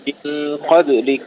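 "قبلك" with a bouncing vowelless b, qab-lik; qalqala on ba. Shown as a demonstration. Teacher correcting a reciter, "قبلك" with bounced still ba. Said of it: "خلي الحرف المقلقل ساكنا".

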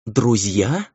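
astonished query; incredulous question. A narrator's reading. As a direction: echo the word in disbelief. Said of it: "удивлённо вскинула идеальные дуги бровей".

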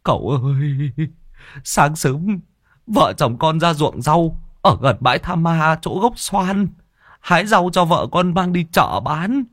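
high-pitched tearful wail; choked sobbing. Acted. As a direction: wail out a desperate plea through acted sobs. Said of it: "khóc nghẹn ngào". (giọng khóc nghẹn ngào)

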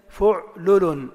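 فعلل split loudly as fu'...lu-lun; pattern demo. Shown as a demonstration. Teacher models the second pattern, loud and split by syllable. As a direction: say فعلل with u on fa and lam. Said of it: "بضم الفاء واللام".

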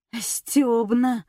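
ordinary delivery

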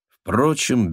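plain reading voice

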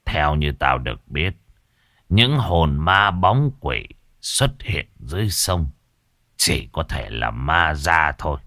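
low ominous sorcerer voice; ominous old master. Acted. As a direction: warn of the river ghost darkly. Master explains the ghost.

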